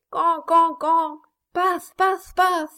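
high, loud mock cackle of can can paf; mock cries. A narrator's reading. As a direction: chant it like a taunting cry. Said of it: "dit Flora; de crier".

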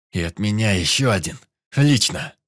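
loud, rising battle cry; taunting shout. Acted. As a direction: yell it as you throw. Throwing a grenade, taunting.

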